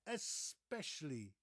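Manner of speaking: slow "Especially" as es-PESH-uh-lee; "Especially" with a clean es at the start; correct especially. Shown as a demonstration. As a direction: draw out the s in "Especially", no k. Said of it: "there's no X".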